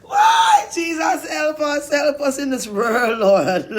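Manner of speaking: high, loud desperate shout to the Lord; crying out to Jesus. Real, not acted. Man crying out to Jesus for help from the devil, loud shout. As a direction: call out to God for help at full voice.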